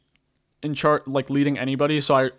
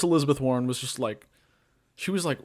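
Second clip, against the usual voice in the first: normal talking voice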